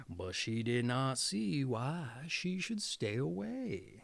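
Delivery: ordinary delivery, nothing marked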